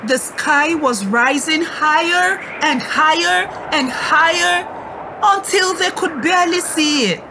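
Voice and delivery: storyteller rising to a high call. A narrator's reading. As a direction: let the voice climb with the sky. Sky rising, voice climbs.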